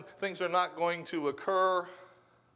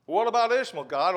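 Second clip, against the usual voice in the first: loud, much higher startled question; protesting question; 4 semitones higher, 10 dB louder than usual. Retold or imitated. Abraham objects to God about Ishmael, loud and startled. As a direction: blurt it out in disbelief.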